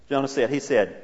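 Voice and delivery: normal talking voice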